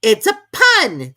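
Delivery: slow, loud, high irritated king; angry. A narrator's reading. The King to the silent court, slow and angry. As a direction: snap it out, annoyed nobody laughed. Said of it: "the king added in an angry tone".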